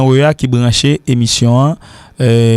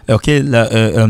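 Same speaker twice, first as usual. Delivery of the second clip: normal talking voice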